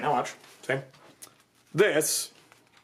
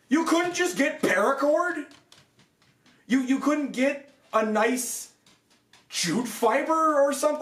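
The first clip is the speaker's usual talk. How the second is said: high, slow, demanding questions; angry exasperation; 10 semitones higher than usual. Real, not acted. Reviewer scolding the armor maker directly, high and angry. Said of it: "I'm actually pissed about this".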